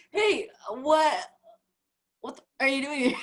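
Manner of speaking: high, laughing blurted what are you doing here; mock the rude ask through laughter; laughing rude version. Shown as a demonstration. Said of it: "you have the class".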